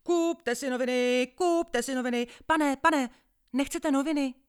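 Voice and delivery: high, loud newsboy cry; calling out papers. Acted. Paperboy calling to passengers, high and loud. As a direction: shout it down the train to sell papers. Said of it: "Vždycky jsem volal ze všech sil".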